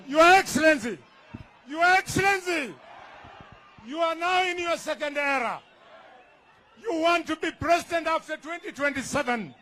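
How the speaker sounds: ordinary talk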